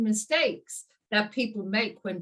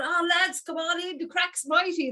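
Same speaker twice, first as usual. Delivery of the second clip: much higher, cheery welcome; excited Irish greeting; 9 semitones higher than usual. Retold or imitated. Welcoming friends in to the craic, much higher and excited. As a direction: call the lads in with gusto. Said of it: "Irish isms when I'm so excited".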